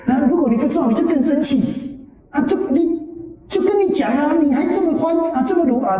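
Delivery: pitched high, hammed-up irritated scolding; mock anger. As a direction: play the annoyed one snapping back. Acting out angry reaction.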